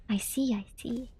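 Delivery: slow unvoiced whisper. Real, not acted. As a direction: whisper it in realization. Whispered realization.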